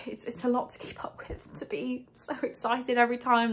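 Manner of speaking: whispered, choked up with real emotion; overwhelmed, breathy confession near tears; emotional whisper. Real, not acted. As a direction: hold back tears and let it drop to a whisper. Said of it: "I'm just gonna get emotional".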